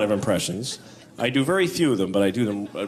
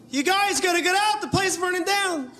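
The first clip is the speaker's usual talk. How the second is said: much higher, strained warning; mismatched guy. Acted. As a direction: shout a warning with the wrong face. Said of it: "a guy who doesn't know how to match his face to what he's saying".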